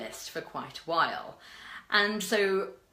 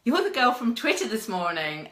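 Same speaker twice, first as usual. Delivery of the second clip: toned-down quote, still raised and loud; corrected imitation; 6 semitones higher, 6 dB louder than usual. Pat recognising her from that morning, toned-down imitation. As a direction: quote him with less squeak, more natural. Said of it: "No, he doesn't speak like that".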